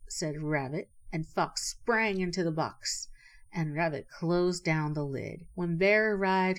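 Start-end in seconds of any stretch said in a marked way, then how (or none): none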